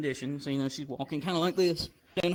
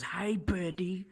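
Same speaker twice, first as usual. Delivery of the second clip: softer, drawn-out imitation of his hello; slow, quiet mock greeting; Ethan's greeting; 3 semitones higher, 6 dB quieter, slower than usual. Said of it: "That's how he talks".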